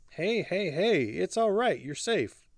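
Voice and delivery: high, gentle comforting tone; soothing reassurance. A narrator's reading. Hardy holds frightened Alla, reassuring. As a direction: soothe them, drawing out the words.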